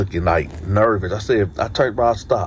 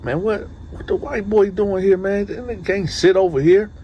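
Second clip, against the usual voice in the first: higher, mock-outraged homeboy voice; suspicious homeboys; 11 semitones higher than usual. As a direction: voice the homies objecting to the newcomer. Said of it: "they looking like".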